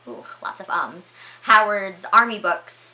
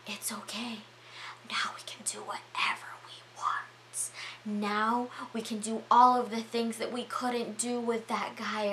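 soft, soothing captor's murmur; creepy whisper; 4 semitones higher, 6 dB quieter than usual. Retold or imitated. Storyteller voices Howard whispering in Michelle's ear, creepily soothing. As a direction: whisper tenderly, easing into hushed voice. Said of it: "whispering in her ear".

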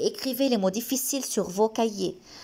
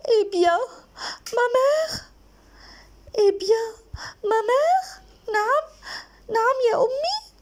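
put-on very high, raised girl's voice; daughter answering; 13 semitones higher, 3 dB louder than usual. Wicked daughter replies to her mother, high-pitched and calling out. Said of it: "Qu'est-ce qu'elle a dit la fille?".

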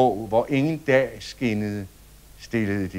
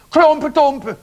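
high, loud shouted title; screamed; 13 semitones higher, 10 dB louder than usual. Acted. Other children screaming their choice of story, high loud yell. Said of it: "skreg andre".

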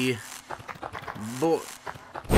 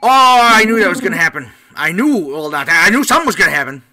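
loud, higher cry of having seen it coming; real loud outburst; 7 semitones higher, 14 dB louder, faster than usual. Real, not acted. Player reacting to what he knew was coming, loud and exasperated. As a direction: exclaim it at full volume.

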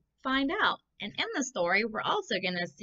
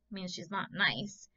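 ordinary delivery

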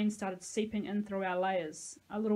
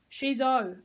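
loud, higher blurted shout; 4 semitones higher, 7 dB louder than usual. A narrator's reading. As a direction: shout it up to the stranger. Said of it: "I shouted".

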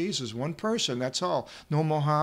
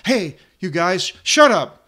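sudden high, loud shout of shut up; crowd telling them off; 4 semitones higher, 11 dB louder than usual. Retold or imitated. The crowd rebukes the two blind men, angry loud shout. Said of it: "the multitude reproached them".

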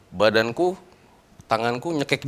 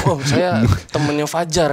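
higher, louder, drawn-out protest; mock alarmed plea; 4 semitones higher, 6 dB louder, slower than usual. Friend mistaken for a thief by the father, acted panicky protest. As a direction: plead your identity in imitated alarm.

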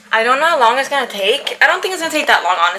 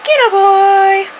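calling out to pet; 11 semitones higher, 7 dB louder, much slower than usual. Real, not acted.

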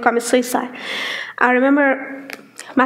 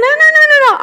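much higher, louder rush of no; quick denial; 14 semitones higher, 7 dB louder, much faster than usual. Retold or imitated. Her friend denying he converts her, a high playful protest.

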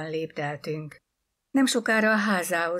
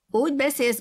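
put-on high, loud child's reproach; reproachful protest; 9 semitones higher, 7 dB louder, faster than usual. A narrator's reading. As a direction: pipe up accusingly. Child scolds Nancy for loud talk.

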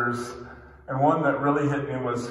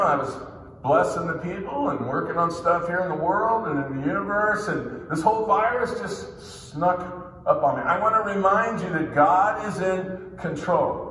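normal talking voice

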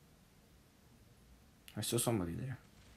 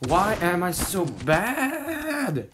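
raging gamer; 6 semitones higher, 4 dB louder, much slower than usual. Real, not acted. Gamer despairs at own play.

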